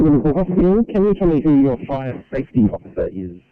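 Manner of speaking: usual speaking voice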